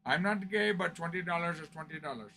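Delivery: quiet, lightly put-on Indian accent; a hint of an Indian accent; mock Indian accent. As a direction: imitate the kids from India, softly. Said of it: "my best attempt at an accent".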